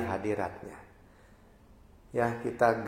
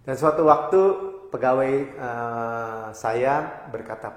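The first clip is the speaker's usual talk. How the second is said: ordinary talk, nothing marked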